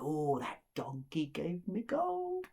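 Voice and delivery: slow, gloating figure voice; greedy innkeeper. Acted. Innkeeper remembers the gold donkey, slow greedy gloat. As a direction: savour it with hushed greed.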